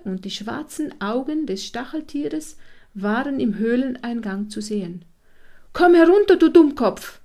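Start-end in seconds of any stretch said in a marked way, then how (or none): 5.7-7.2 loud, high scolding call from the hedgehog; gerufener Befehl. A narrator's reading. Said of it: "rief er". (laut und schimpfend gerufen)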